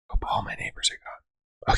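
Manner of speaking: very soft, voiceless aside; hushed whisper. Real, not acted. Vlogger worrying about neighbors after yelling, hushed whisper. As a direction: whisper it as if the neighbors might hear.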